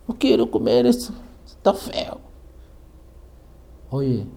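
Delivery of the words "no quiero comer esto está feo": put-on high, tearful little-girl whine; mock child crying. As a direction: imitate a sulky child in tears. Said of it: "Estaba llorando". (niña llorosa imitada, voz aguda)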